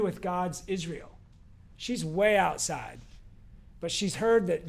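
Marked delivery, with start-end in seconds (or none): none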